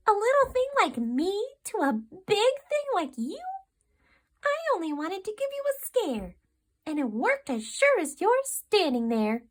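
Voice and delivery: high, teasing little mouse voice; playful mouse gloating; cheeky mouse. A narrator's reading.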